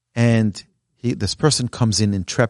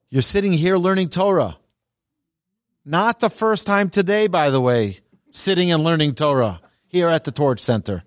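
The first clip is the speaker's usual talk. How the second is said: higher, fervent encouragement; preaching uplift; 7 semitones higher than usual. Acted. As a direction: lift the room up, passionately. Impassioned encouragement to audience.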